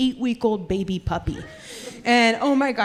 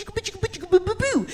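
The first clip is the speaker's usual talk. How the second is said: higher cooing boo boo; baby talk; 8 semitones higher, 5 dB quieter than usual. Retold or imitated. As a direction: coo it at a puppy.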